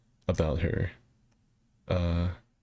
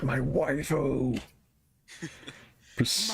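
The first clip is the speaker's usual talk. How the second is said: excited anime fan; 7 semitones higher, much slower than usual. Retold or imitated. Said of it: "he's like".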